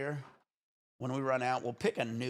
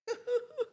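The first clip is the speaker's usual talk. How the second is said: high laugh. Real, not acted. Streamer laughing after his hole in one. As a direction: burst of high giddy laughter.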